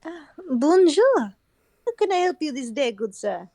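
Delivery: put-on French accent. Opera house head greeting a visitor, French accent. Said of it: "poor French accent".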